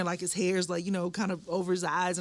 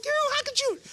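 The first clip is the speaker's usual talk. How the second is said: much higher playful reproach; teasing George for the scare; high mock scold. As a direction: squeal it as teasing blame.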